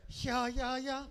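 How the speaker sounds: high, loud, stretched announcer call; hyped emcee shout; announcer hype. Acted. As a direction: boom it out like a club MC. Host hypes the DJ.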